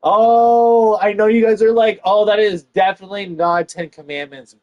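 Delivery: high, loud exclaim; loud outburst. Real, not acted. Comic loudly anticipating the audience's objection. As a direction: burst out loud at the crowd.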